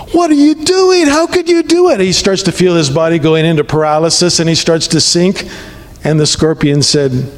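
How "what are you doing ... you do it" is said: very high-pitched, incredulous mock outcry; shocked frog. Stung frog confronts the scorpion mid-river, in high-pitched shock. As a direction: squeal it like a betrayed storybook frog.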